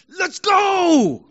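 high, loud victory cheer; shout of triumph. Acted. Crowd after the big tackle, triumphant shout. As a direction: roar it out in triumph. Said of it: "it's like a shout of triumph".

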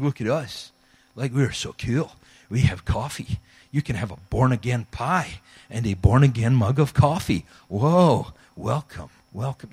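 lightly voiced smug churchgoer; a hint of smug welcome; mock cool churchgoer. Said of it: "we didn't talk like that 'cause we're Scottish".

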